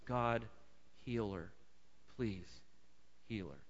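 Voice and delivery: whisper-soft begging for her healing; humble plea. Retold or imitated. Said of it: "his response is".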